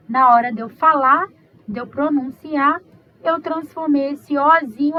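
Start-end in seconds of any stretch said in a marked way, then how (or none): none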